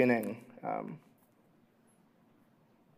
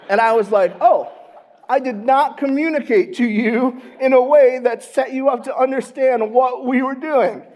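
higher and louder, laughing through it; 11 semitones higher, 10 dB louder than usual. Real, not acted. Pastor to church, laughing at his own hunting blunder.

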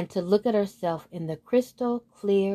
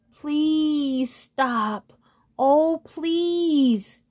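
high, drawn-out storybook plea; begging; 7 semitones higher, 3 dB louder, much slower than usual. A narrator's reading. Tickled moon to the unicorn, begging. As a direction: beg with long, stretched pleases. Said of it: "begged the moon".

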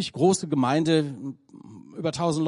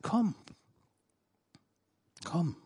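God's tender invitation; 3 semitones lower, 4 dB quieter than usual. Pretend. God invites the sinner home, tender and soft. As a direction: whisper God's welcome like a father.

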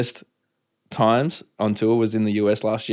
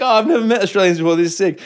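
thrilled sheriff; 8 semitones higher, 3 dB louder, faster than usual. Retold or imitated. Acts out sheriff thrilled by Australians.

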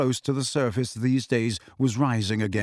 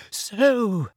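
put-on high, nervous girl's voice; very high-pitched; 9 semitones higher than usual. A narrator's reading. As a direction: pitch it up with nerves. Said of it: "her voice very high-pitched again".

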